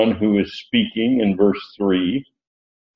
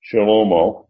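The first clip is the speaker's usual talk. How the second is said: ordinary delivery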